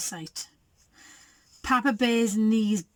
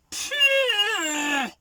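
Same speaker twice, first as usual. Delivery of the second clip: high, drawn-out disgusted gag; gagging; 10 semitones higher than usual. A narrator's reading. Baby Bear smells something awful, gagging. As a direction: gag at a foul stink. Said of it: "Baby Bear gagged".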